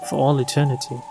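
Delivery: ordinary delivery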